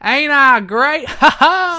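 high, loud, drawn-out brag with a laugh; Jeff Jarrett. Acted. Co-host echoing the Jarrett impression, loud, slow and cackling. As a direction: ham up the wrestler's catchphrase.

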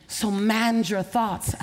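slow, each word weighted; slow charge. Acted. Preacher charges the audience, slow and weighty. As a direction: press each word slowly.

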